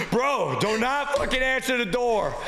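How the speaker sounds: gasping, high-pitched urgent plea; mock panic after a sprint; acted breathless panic. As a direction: act out of breath from fleeing. Fleeing, slams door, pleads to roommates.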